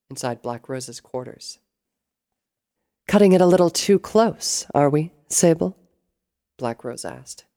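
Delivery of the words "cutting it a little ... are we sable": high, loud, arch villainess. A narrator's reading. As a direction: needle an underling with raised voice.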